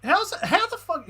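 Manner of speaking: high, stunned outburst; shocked disbelief. Real, not acted. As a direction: exclaim it in total disbelief. Disbelief at a game call.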